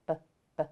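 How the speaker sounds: "B, b" as two short buh sounds; "B, b" as separate starting sounds, not the letter name; isolated letter sound. Shown as a demonstration. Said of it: "What sound do you hear at the beginning".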